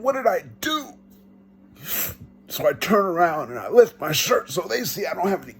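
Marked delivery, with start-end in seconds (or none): 0.0-0.9 reenacted scream, held back; retold scream. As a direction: cry it out in protest without full volume. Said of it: "And I scream".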